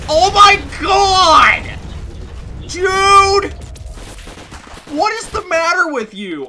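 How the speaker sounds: loud, high, stretched yell; horrified yelling at the wrecker; outraged shout. Real, not acted. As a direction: scream in disbelief. NPC wrecks house.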